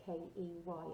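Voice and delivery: normal talking voice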